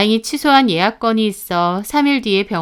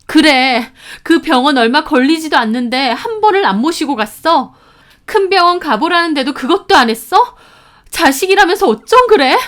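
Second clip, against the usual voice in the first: loud, high, outraged questioning; angry reproach; 7 semitones higher, 6 dB louder than usual. A narrator's reading. Sister blaming brother for skipping the hospital, angry reproach. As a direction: reproach him, climbing higher.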